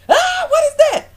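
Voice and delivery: high, loud startled shriek; mock scream. Imagining a surprise ring found in a kiss, screaming. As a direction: shriek as if shocked mid-kiss. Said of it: "I would've screamed".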